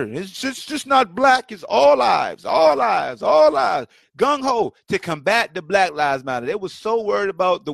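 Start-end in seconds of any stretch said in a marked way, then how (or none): 0.0-3.9 mock slogan. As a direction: jeer at the slogan. Mocking all lives matter crowd.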